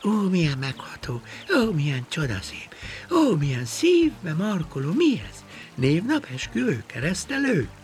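high, swooning oohs of wonder; amazed gushing. Acted. Worm marvelling at the flower bouquets, high awestruck gushing. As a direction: gush in a high, moved voice. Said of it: "ő is ámulva nézte".

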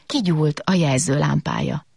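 ordinary delivery

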